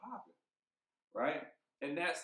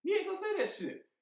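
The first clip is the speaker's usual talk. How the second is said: much higher, louder exclamation; emphatic outburst; 6 dB louder than usual. Real, not acted. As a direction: blurt it out, worked up and incredulous. Heated rant about Terry Crews.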